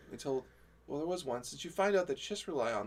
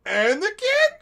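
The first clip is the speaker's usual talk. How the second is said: high, loud, slow sly alien greeting; Nash's Chiss voice; 14 dB louder, slower than usual. Retold or imitated. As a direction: stretch it out high and loud like a smug blue alien. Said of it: "just Nash's Chiss going".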